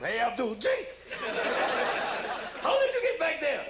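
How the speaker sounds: put-on high, laughing, surprised generic Arabian accent; a startled Iraqi soldier in a hammed-up Arabian accent; mock Iraqi accent. Iraqi soldier surprised by Americans behind, mock Arabian accent. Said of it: "Iraqi accent?".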